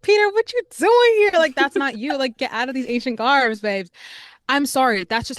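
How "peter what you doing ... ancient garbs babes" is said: high, loud teasing call-out; playful high mock scolding; teasing Peter. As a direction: tease the actor, pitched way up. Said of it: "I'm like".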